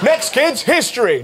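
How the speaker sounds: ordinary talk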